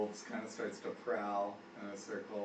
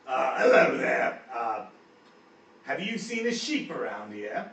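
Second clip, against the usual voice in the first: slow, loud gruff greeting; loud, drawn-out orc hello; orc Guz greeting; 8 dB louder, slower than usual. Acted. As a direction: lean in and ask like a big orc. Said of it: "Guz says".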